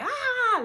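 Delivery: high, loud "ah" scream; mock scream. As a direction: imitate a woman screaming through a contraction. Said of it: "she was screaming like".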